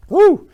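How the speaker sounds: very loud, high whoop of delight; sudden high thrilled whoop. Real, not acted. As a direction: burst out with a big "woo". Overjoyed at the gift.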